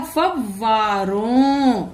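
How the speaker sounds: फव्वारों drawn out slowly with a doubled व; फव्वारों stretched syllable by syllable, the व doubled; slow careful reading. Shown as a demonstration. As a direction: sound out फव्वारों slowly for children. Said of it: "क्या बन जाएगा?".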